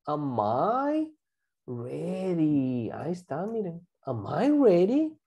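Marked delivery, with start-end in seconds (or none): 0.1-2.9 slow, lower, drawn-out words with a pause; slow model phrase. Shown as a demonstration. Teacher models a new question for class, slow and segmented. 4.1-5.2 words linked, rising on "ready"; joined-up question. Shown as a demonstration. Teacher repeats the question for class, connected and rising.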